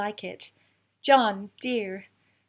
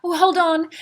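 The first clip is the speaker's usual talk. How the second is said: sudden exclamation; 6 semitones higher, 7 dB louder than usual. Acted. Meg stops, rushes to John.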